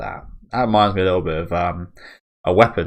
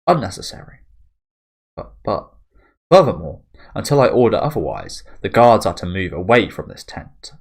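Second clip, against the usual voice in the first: imperious commander; 6 dB louder than usual. Acted. Farnese overruling the guard, louder and imperious. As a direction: shut him down coldly.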